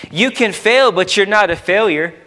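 ordinary talk, nothing marked

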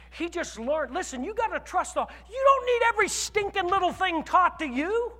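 preacher meddling with the flock, heated; fervent preaching. Acted. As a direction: press the point on the congregation. Preaching aside to congregation.

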